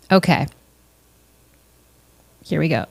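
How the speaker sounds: usual speaking voice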